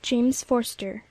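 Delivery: usual speaking voice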